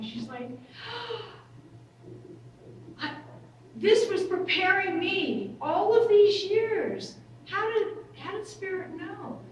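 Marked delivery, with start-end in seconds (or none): 0.6-1.8 a high, hushed sobbing gasp. Retold or imitated. Client overwhelmed by a realisation, gasping through tears. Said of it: "hysterically crying". 3.0-9.4 higher, slowed, a little louder in awe; mildly overwhelmed retelling of a breakthrough; tearful revelation. Retold or imitated. Client realising her trauma prepared her, tearful awe. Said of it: "hysterically crying".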